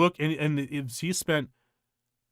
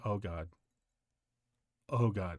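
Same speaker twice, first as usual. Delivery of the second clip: much softer and lower, inward dread; dreading under breath; 5 semitones lower, 8 dB quieter than usual. Retold or imitated. Boyfriend reacting as the wife drops her fork, quiet dread.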